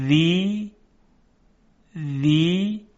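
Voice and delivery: ذی drawn out with the tongue tip at the teeth; dhal syllable demo. Shown as a demonstration. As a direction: isolate ذی and lengthen its vowel. (ذی کشیده با ذال عربی)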